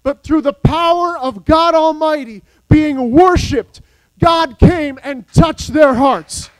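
rising, ringing sermon delivery; full-force Sunday preaching; fiery preaching. Acted. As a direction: preach it to the back pew.

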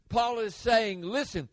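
ordinary delivery

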